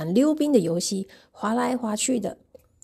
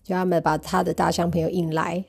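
plain reading voice

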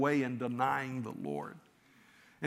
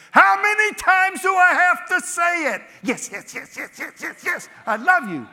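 shrill, much higher and louder, fed-up yelling; exasperated outburst; 14 dB louder than usual. Shown as a demonstration. Preacher mocking Peter's imagined exasperated reply. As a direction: throw up your hands and yell it. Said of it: "it wasn't exasperation saying".

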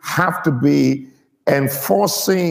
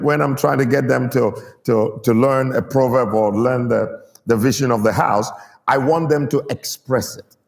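normal talking voice

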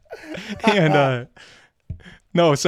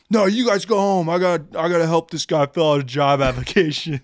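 higher, louder put-on drunk buddy voice; mock drunk friend; 3 semitones higher, 4 dB louder than usual.

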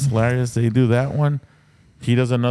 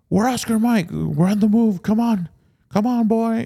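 put-on higher, small boy voice; a kid rallying his teammate, in a higher kid voice; little kid voice; 10 semitones higher than usual. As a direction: play a kid bossing his squad. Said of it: "it actually sounds like a freaking little kid".

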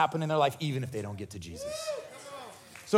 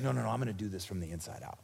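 soft Jesus voice; 8 semitones lower, 8 dB quieter, faster than usual. Acted.

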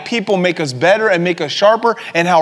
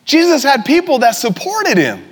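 preaching at full force; 4 semitones higher than usual. Acted. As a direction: drive it home to the congregation, rising.

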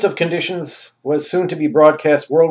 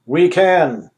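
smug ruler; 4 semitones higher, 6 dB louder than usual. Acted. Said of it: "Anus returned".

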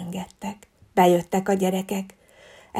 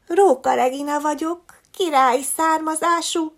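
put-on haughty fox girl; 7 semitones higher than usual. A narrator's reading. Fox girl boasts her royal birth.

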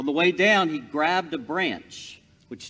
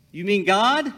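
higher and louder, stunned disbelief; astonished; 7 semitones higher, 9 dB louder than usual. Acted. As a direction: blurt it out in shock. Jack hears it is God.

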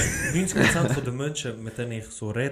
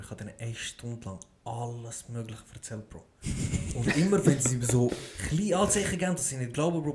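normal talking voice

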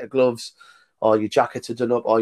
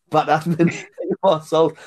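laughing repeat; 4 semitones higher than usual. Real, not acted. Repeating the DS guy's words to the host, laughing. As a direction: laugh while you echo the line.

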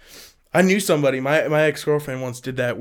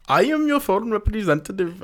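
higher-pitched imitation Indian accent; mock Indian accent; 6 semitones higher than usual. Imitating an unwanted phone caller, mock Indian accent. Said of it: "the worst one is Indian".

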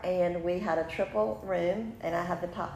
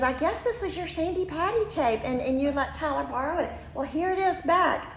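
put-on much higher, breezy girlish voice; friend's voice; 9 semitones higher than usual. Acting out Alyssa returning the tape, high and breezy. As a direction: hand it back with a cheery upward lilt. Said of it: "she goes".

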